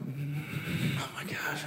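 hushed, fearful whispering; acted nervous whisper; tense whisper. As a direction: whisper it while creeping up on something. Creeping up on the hidden mouse.

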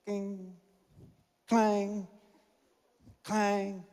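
soft mock clangs of missed shots; imitated rim clang. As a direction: voice the ball bouncing off the rim, quietly.

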